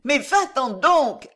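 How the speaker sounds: high, loud, drawn-out plea; slow, high-pitched exhausted entreaty; imploring. A narrator's reading. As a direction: beg desperately, at the end of your strength. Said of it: "implora de nouveau la jeune femme".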